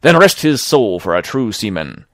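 honest seaman defying the pirate, loud and angry; heroic defiance. Acted. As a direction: hurl it at the murderer. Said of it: "Tom flashed out like a hero".

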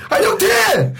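high-pitched furious mock scream; screaming in anger. Older friend in the car scolding the novice driver, screaming. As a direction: shriek like an enraged passenger at the driver. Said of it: "비명, 소리를 지르는지".

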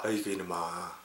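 very soft, low grumble; muttered exasperation. Acted. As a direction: mutter it to yourself in mock despair. Muttered aside after acted order.

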